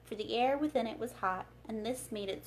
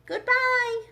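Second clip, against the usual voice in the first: loud high shout of farewell; the whole crowd cheering goodbye; 14 semitones higher, 10 dB louder than usual. Acted. The people shout farewell to Oz, loud and high. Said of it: "shouted everyone".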